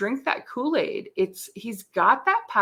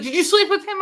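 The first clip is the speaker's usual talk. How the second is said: high, louder incredulous ask with a laugh; mock scandalized mom; shocked question; 11 semitones higher, 6 dB louder, faster than usual. Mom asking daughter about the night, high and shocked.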